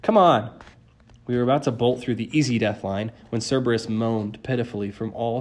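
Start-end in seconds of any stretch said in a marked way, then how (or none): 0.0-0.5 raised, louder prompt; urgent hurrying. A narrator's reading. Grover eager to flee, urging them on. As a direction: hurry your friends along.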